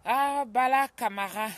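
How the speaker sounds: loud, slow, drawn-out greeting; calling out a greeting. Real, not acted. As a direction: call the name out warmly across the room. Calling out a viewer's name.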